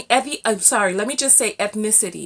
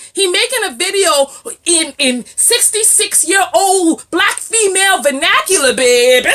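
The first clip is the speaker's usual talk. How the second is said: loud, higher-pitched, emphatic ranting; exasperated shouting; 7 semitones higher, 6 dB louder than usual. Real, not acted. Vlogger ridicules a man's way of talking, loud exasperated rant. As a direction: build to a shouted final word.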